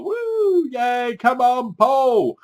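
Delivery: high, drawn-out whoop of support; a slow, high "woo, yay" for the hero; mock cheering. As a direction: cheer Paul on like a fan in the stands. Cheering on Paul.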